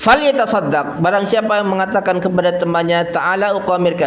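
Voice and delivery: hadith recitation. Acted. Preacher completing the gambling hadith, in high recitation. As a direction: lift the voice to recite and translate.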